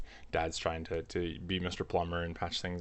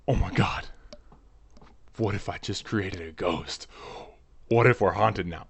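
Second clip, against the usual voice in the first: freaking out; 5 dB louder than usual. Retold or imitated. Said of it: "my mom is like".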